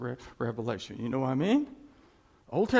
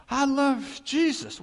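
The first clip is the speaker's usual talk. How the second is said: mock churchgoer; 9 semitones higher, 5 dB louder, slower than usual. Mocking a churchgoer's excuse.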